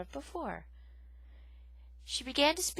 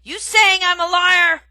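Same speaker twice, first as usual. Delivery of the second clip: very loud, slow angry roar; 10 semitones higher, 19 dB louder than usual. Acted. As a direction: roar the challenge. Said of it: "the porcupine roared".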